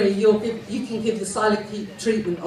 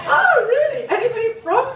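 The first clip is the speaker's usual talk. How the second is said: much higher, louder delighted oh really; surprised; 13 semitones higher, 5 dB louder than usual. Real, not acted. Comic to audience member who has heard of South Oxley, surprised squeal. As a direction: squeal of mock amazement.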